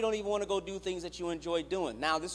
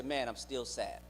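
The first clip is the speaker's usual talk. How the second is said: quietly sad; 6 semitones lower, 7 dB quieter than usual. Retold or imitated. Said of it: "Then you say".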